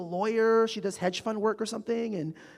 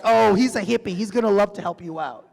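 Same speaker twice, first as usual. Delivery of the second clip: loud, laughing retelling of a friend's line; laughing friend quote; 3 semitones higher, 9 dB louder than usual. Retold or imitated. Retelling a friend's eager offer to a panel, loud and laughing. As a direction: bubble over with laughter as you quote the friend. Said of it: "my friend was like".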